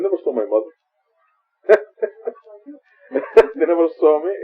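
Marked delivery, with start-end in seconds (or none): 1.7-4.3 laughing. Real, not acted. Laughing at the callers' insults.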